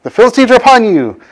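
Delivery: high, half-shouted warning; mock alarm call. Delilah raises the alarm again.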